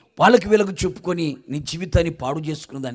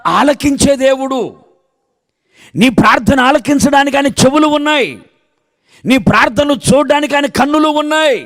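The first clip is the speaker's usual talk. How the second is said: raised, higher and louder preaching cadence; fervent preaching; 8 semitones higher, 10 dB louder than usual. Acted. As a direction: preach it out to the congregation with full force. Preaches at full force.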